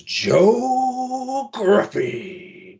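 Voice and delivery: very high, drawn-out name call; ring announcer. Acted. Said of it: "on the Titantron, it goes".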